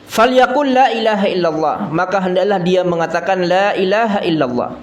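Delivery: high, sustained preacher's recital; hadith recitation. Acted. Preacher quoting the Prophet's instruction, in high recitation.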